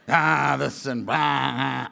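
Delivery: low grumbling moaner, trailing down; mock complainer. Imitating negative complainers.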